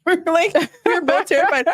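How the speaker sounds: giggling at the memory of being terrified; laughing. Real, not acted. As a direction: laugh through the words. Laughing while recalling the relationship.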